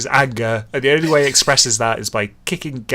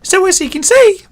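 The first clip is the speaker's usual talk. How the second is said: higher, louder character voice; story character's line; 7 dB louder than usual. Acted. Character sums up for the gang, high loud voice. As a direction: pitch it up high and project the line.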